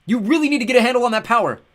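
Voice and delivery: sustained high, loud shouting; yell over wind. A narrator's reading. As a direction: holler the scolding over rushing wind. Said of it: "I yelled over the wind".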